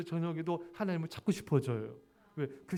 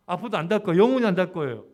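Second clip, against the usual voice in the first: much louder, declaring it with a grin; emphatic joking vow; 11 dB louder, faster than usual. Real, not acted. Pastor vowing never to hang a clock, loud and laughing. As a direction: ham up the vow, laughing at the end.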